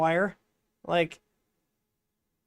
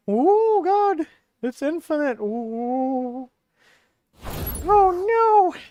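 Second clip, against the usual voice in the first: surprised; 8 semitones higher, 3 dB louder, much slower than usual. Real, not acted. Surprised at the print result.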